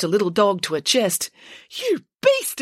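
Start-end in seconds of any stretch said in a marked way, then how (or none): 1.7-2.6 very high indignant accusation; accusing. Acted. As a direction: hurl the accusation at the constable. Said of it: "accused Loretta".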